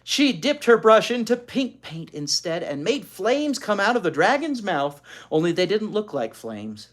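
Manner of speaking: usual speaking voice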